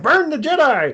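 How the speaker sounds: mock gleeful outburst in a high voice; Yoda. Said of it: "he starts laughing uncontrollably".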